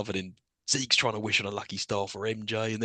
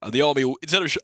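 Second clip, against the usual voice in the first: ordinary talk, nothing marked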